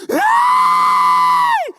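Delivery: high, loud sudden shriek of fright. Retold or imitated. Panicking woman hearing bullets could pierce door, sharp shriek. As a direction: let out a sudden scared yelp.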